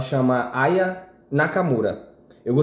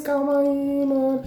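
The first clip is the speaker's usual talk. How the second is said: high mock imitation of a friend; 11 semitones higher, slower than usual. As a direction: mimic him, pitched way up. Friend's reaction acted out.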